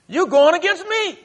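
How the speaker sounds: soaring pulpit voice as God; impassioned preaching. Acted. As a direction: press the point home with full force. Preacher voicing God, escalating.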